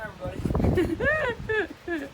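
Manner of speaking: long, loud, high drawn-out hello; excited greeting. Real, not acted. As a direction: stretch it out like a host. Greeting viewers in the cave.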